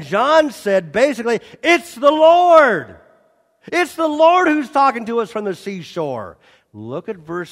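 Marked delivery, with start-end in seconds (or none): 1.6-6.4 excited recognition. Retold or imitated. John recognizing Jesus.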